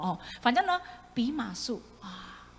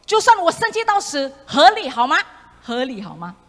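loud, high angry retort; Jonah's furious reply, much higher; 5 semitones higher, 14 dB louder than usual. Retold or imitated. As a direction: snap back at God furiously, much louder. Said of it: "他就很生气的说".

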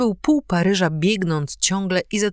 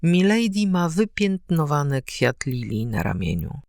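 ordinary delivery

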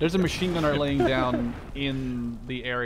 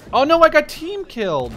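dismayed; 13 semitones higher, 11 dB louder than usual. Real, not acted. Just killed by teammate.

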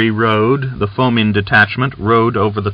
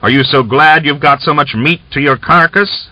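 louder, higher angry shout; 6 semitones higher, 4 dB louder than usual. A narrator's reading. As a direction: bark it back at her. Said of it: "he said in a loud voice".

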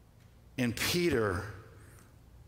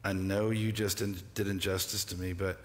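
Jesus' tender commission; 5 semitones lower, 4 dB quieter, faster than usual. Retold or imitated. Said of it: "set him on mission and said".

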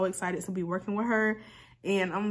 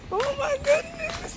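very high, drawn-out squeal; overwhelmed excitement; slower than usual. Real, not acted. Vlogger reaching the long-awaited package, squealing.

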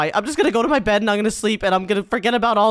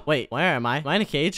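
normal talking voice

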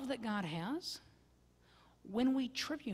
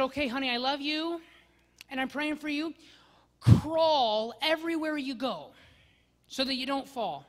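higher, louder, eager reassurance; cheery reply; 5 semitones higher, 8 dB louder than usual. Retold or imitated. Husband cheerily texting his ill wife to crawl. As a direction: brightly offer silly advice. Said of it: "and I said".

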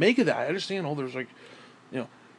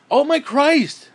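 higher, louder, slow gasp of exasperation; exasperated outburst; 9 semitones higher, 7 dB louder, slower than usual. Real, not acted. Ranter out of breath after his long list, exasperated outburst. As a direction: burst out, spent and fed up. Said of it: "I can't even breathe".

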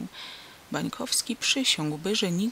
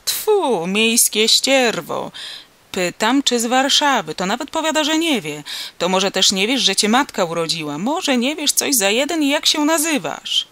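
loud, pitched-up scolding tirade; outraged farmer building to a loud harangue; indignant peasant farmer; 4 semitones higher, 7 dB louder than usual. A narrator's reading. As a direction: berate an ungrateful stranger, spitting with contempt. Farmer figure berating the stranger.

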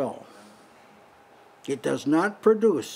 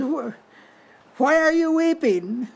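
much higher, airy angel asking Hagar; angel's voice; 9 semitones higher than usual. Acted. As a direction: lift way up as the angel calling Hagar. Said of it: "this is an angel talking".

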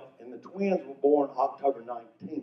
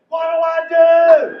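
high, loud, slow cry of panic; mock frantic wife; panicked wife; 13 semitones higher, 12 dB louder, slower than usual. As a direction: panic as the cow drags her.

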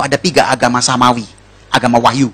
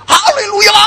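very high, hammed-up scream; panicked mock scream; much slower than usual. Rider sees a ravine ahead, panicked high scream. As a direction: scream it in comic alarm. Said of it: "Dia teriak".

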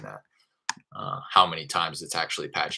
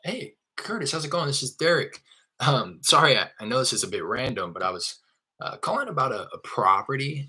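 pitched-up demo of a cold-call opener; familiar sales script; 6 semitones higher than usual. Shown as a demonstration. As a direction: open high and friendly, then apologize casually. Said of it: "after I say".

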